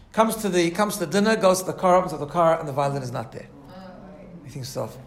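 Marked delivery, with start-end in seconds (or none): none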